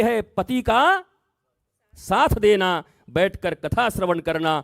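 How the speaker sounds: high, loud impassioned sermon; preaching at full force. Acted. Preacher warning the devotees, high and loud. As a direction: hammer the warning home to the crowd.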